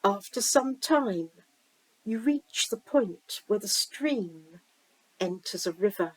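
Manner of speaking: slow-paced visualization reading with long pauses; guided meditation. Acted. Reader guiding listeners down a stream to the sea, guided meditation. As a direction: lead the listener gently, leaving room to imagine.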